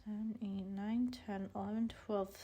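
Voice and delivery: counting under her breath; soft whispered tally. Real, not acted.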